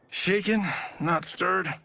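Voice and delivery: mock spy. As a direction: toss it off like a hammed-up secret agent. Joking martini order like a spy.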